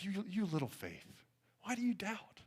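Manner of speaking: very soft, tender chiding; hushed, affectionate reproach; as to a child. Retold or imitated. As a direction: gently chide a little one. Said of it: "speaks to him as a child".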